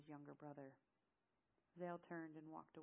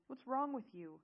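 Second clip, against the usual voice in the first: loud, higher confrontation; angry demand; 7 semitones higher, 9 dB louder than usual. Acted. Zale to the crying woman who turns away, angry demand. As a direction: demand an answer, exasperated.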